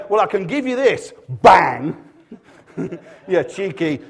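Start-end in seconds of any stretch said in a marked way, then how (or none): 0.1-2.0 mock God's retort. Preacher plays God punishing Abraham.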